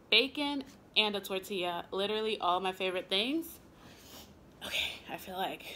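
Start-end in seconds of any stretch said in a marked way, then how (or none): 4.6-5.6 breathy whispered aside; whispering. Real, not acted. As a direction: drop to a whisper. Aside while eating on camera.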